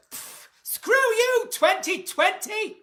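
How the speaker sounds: high, slow mock defiance at the year; mock bauble smash. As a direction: spit it out high and slow at twenty twenty. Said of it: "grab a bauble and just go".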